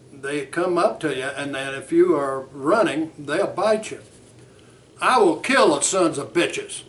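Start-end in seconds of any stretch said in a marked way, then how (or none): 5.0-6.8 loud, fast threat; defiant rage. Acted. Bert telling Frank he'll fight the dogs, furious. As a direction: refuse to hold back any longer.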